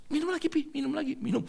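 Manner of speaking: put-on high, insistent child voice; eager child urging. Child urges father drink more.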